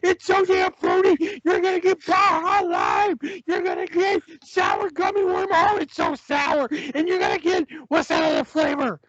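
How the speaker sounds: hyped sponsor ad read. Acted. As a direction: sell the energy drink with gusto.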